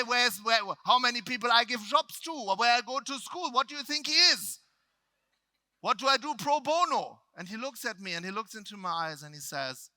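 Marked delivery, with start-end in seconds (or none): 0.0-7.2 put-on high, loud, heavy German accent; flustered shouting in thick German-accented broken English; agitated German-English. Upset speaker to lead trainer, agitated German-accented English. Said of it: "like Jinglish".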